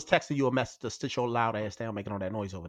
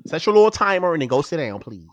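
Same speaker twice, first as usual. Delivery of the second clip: exasperated order; 4 semitones higher, 7 dB louder than usual. Real, not acted. Ordering noisy cohost to sit.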